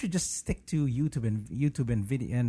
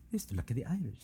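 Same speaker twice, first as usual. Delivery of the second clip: softer attempt at an Irish accent; tentative Irish accent; 6 dB quieter, faster than usual. Shown as a demonstration. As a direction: try the Irish accent out quietly, unsure of it. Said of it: "How, how do you say that".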